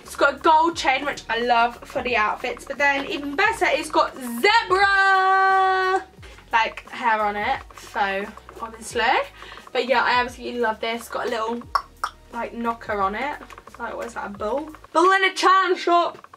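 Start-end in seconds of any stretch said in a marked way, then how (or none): 4.1-6.0 long drawn-out high "zebra"; playful excited cry. Real, not acted. Vlogger showing off her new bag, playful drawn-out cry. Hammed-up reveal of zebra detail. 14.9-16.3 high, playful put-on voice; silly put-on voice. Vlogger joking about the bag's knocker, silly put-on voice.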